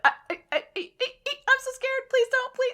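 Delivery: high, loud stammering fright; terrified stammer. Retold or imitated. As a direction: squeak out the panic. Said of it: "she's like".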